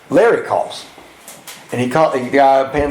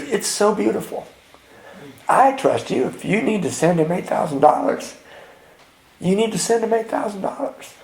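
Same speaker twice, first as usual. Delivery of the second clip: high, soft, tender wife's voice; imitating trusting wife; 6 semitones higher, 5 dB quieter than usual. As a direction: reassure him gently, pitched up and hushed. Voices his wife giving her trust.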